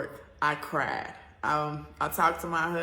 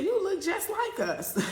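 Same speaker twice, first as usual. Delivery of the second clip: much higher, laughing delight; airport lady; 4 dB louder than usual. Retold or imitated. Airport worker telling the traveler, high laughing delight. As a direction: gush that she looks just like them. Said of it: "She said".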